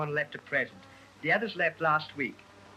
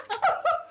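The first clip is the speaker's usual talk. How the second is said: high giggle; 4 dB louder than usual. Acted. Maid giggles.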